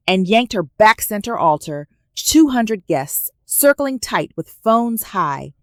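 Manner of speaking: usual speaking voice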